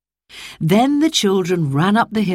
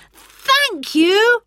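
put-on children's voices; 3 dB louder than usual. Children thanking together.

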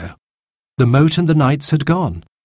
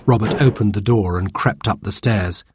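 ordinary delivery, nothing marked

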